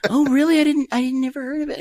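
high falsetto, taken in; put-on girly voice, innocently puzzled; mock party girl. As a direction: imitate a gullible girl, high-pitched. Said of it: "they're like".

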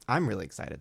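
ordinary talk

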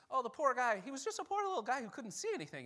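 high, quick Irish leprechaun lilt. Retold or imitated. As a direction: play it like a cheeky leprechaun. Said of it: "we say that like a leprechaun".

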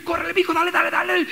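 put-on high, pushy urging of the kid; hurrying parent. Padre apurando al hijo. (padre apurando al hijo, tono agudo y apremiante)

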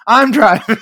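retold girlfriend insisting she drives; firm insistence. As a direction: claim the wheel with a firm call. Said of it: "She's like".

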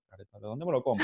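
soft mock grumble; muttered retort. A touch of brother's soft grumble at the usher. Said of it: "Así".

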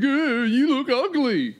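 high, drawn-out jeer; jeering commenters. Acted. Host imitating commenters mocking his looks, jeering.

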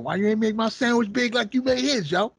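aggrieved retold yell about the sandwich; angry demand. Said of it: "He's like".

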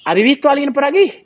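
high, loud badly done Seville accent; the guard asking, in a botched Sevillian accent. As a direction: put on a clumsy Andalusian accent as the guard. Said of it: "me sale fatal el acento, el acento sevillano".

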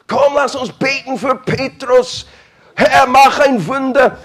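high, loud, gushing prayer voice; fervent praying Christians. Pretend. Church members pleading for Peter, high fervent prayer. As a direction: cry out to God for a miracle, high and loud.

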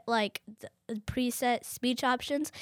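ordinary talk

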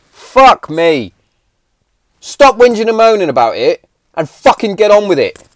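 loud, high fed-up rant; loud angry rant. Real, not acted. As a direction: let the frustration boil over. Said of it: "Rant over on that one".

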